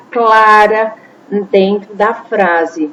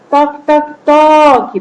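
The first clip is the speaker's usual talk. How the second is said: knocking sound; 6 semitones higher, 4 dB louder than usual. Shown as a demonstration. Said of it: "bateram na porta".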